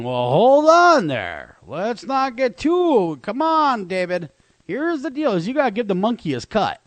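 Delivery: mock PETA spokesman. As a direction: voice PETA lecturing David, hammed-up. PETA voiced as quoted speaker.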